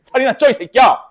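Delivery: loud, high mock-angry yell; mock abuse. Chocolate giver cursing at the one eating, mock-angry yell. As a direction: hurl the insult with comic exasperation. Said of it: "이러면서 이제".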